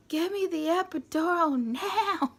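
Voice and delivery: high, loud, drawn-out order; hammed-up desperate demand, every word leaned on; mock furious demand. Patient in pain cutting through the argument, mock furious demand.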